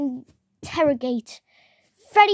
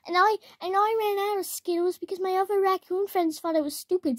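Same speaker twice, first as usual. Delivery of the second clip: usual speaking voice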